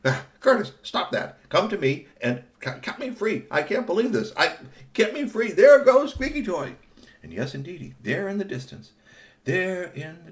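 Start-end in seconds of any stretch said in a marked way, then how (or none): 0.0-6.8 upset tied-up friend. Acted. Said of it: "Timmy Tommy Toby was like".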